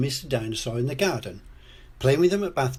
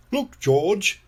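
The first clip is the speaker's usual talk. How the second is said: higher, louder storybook dad; eager pointing-out; 8 semitones higher, 5 dB louder than usual. A narrator's reading. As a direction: call a child over to look. Daddy Pig points out a toy.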